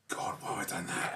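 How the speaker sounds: hushed embarrassed whisper. Retold or imitated. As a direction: whisper it to yourself. Embarrassed self-talk after a blunder.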